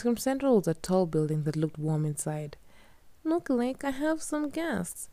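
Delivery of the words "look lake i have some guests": Katya's voice. A narrator's reading. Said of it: "Katya said".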